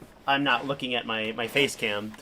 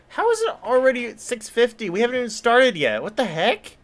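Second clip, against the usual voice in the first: high, loud disbelief at the late hour; what the heck, genuinely caught off guard; rising, incredulous how-is-it-so-late; surprised; 9 semitones higher, 5 dB louder than usual. Real, not acted. Shocked at how late the stream is.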